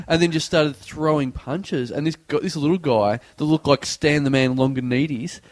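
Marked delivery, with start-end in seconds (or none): none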